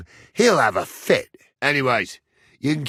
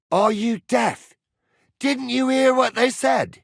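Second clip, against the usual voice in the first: high, raised angry questioning; exasperated scolding; 10 semitones higher than usual. Acted. Man asking someone if they are deaf, exasperated shout. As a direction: berate him for not listening.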